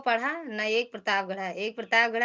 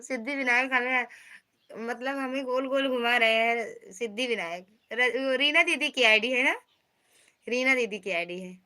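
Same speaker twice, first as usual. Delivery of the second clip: ordinary talk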